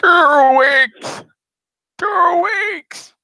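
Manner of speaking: high shrieking cry. Acted. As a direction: shriek it out in mock despair. Mocking shriek of two weeks.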